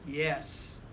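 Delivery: ordinary delivery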